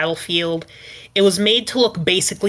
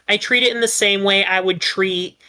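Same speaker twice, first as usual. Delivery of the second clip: ordinary talk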